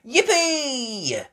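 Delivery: put-on high, loud child's squeal of joy; excited cheer. Little pig delighted about pancakes, cheering. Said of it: "cheered Peppa".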